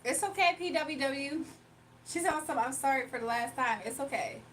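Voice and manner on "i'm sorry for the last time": ordinary talk, nothing marked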